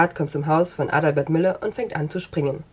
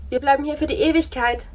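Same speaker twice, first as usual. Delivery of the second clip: cheerful soldiers; 9 semitones higher than usual. Acted. Soldiers announce staying forever.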